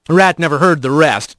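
ordinary delivery, nothing marked

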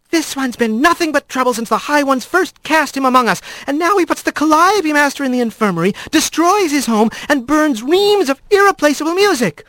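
high-pitched, scolding magistrate squirrel; indignant magistrate. Acted. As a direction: rattle off the accusations in a high, cross voice. Voices an angry magistrate in story.

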